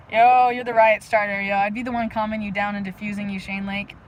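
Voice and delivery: normal talking voice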